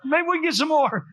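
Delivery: high-pitched, frantic protest; mock panic. Husband begs wife not to dump medicine, mock panic. My faith got shook.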